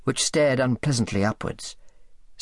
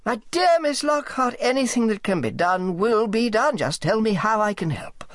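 much higher, swooping gentlemanly voice; effusively helpful Higgs; 9 semitones higher than usual. A narrator's reading. Higgs offers Sally any help, effusively obliging.

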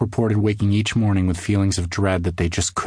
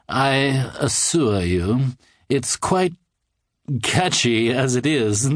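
very slow, higher, grinning voice; smug creepy man; 5 semitones higher, much slower than usual. A narrator's reading. Odd man refusing to change his ad, smug and creepy. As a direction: savour every word with a stretched smile.

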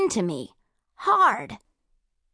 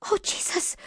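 breathless whispered gasp; frightened whisper; 9 semitones higher, 4 dB quieter than usual. A narrator's reading. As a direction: whisper it in terror. Girl sees him coming.

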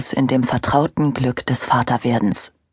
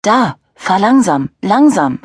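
put-on high, loud eager child's call; urgent, loud, high child voice; excited child calling; 5 semitones higher, 5 dB louder than usual. A narrator's reading. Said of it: "rief der Vierjährige".

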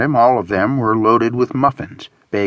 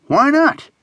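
very high, challenging question; exasperated question. A narrator's reading. Friend wanting to go home, exasperated. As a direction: throw the question back up high.

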